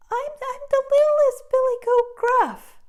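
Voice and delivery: very high, stammering little voice; tiny scared goat. Acted. Littlest goat answering the troll, very high and timid. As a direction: squeak it out nervously.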